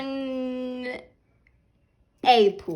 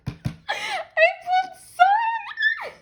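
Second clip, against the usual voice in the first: shrieking through laughter and tears, high and slow; overjoyed screaming at the card; ecstatic scream; 6 dB louder, much slower than usual. Real, not acted. Fan unboxing, screaming with joy at her pull.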